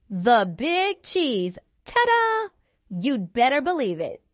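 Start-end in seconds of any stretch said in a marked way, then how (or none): 0.1-1.6 slow, stretched "the big cheese" with big leaning hard; drawn-out proud title. Acted. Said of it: "say it with me". 1.8-2.5 high, bright showman's "Ta-da!"; triumphant ta-da. Acted. Triumphant self-announcement.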